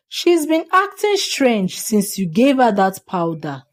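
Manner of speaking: usual speaking voice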